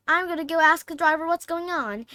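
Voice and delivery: put-on girl's voice. Hermione voiced, higher.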